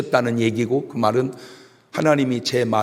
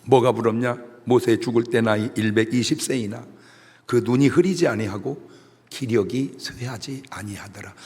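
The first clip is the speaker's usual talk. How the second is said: ordinary delivery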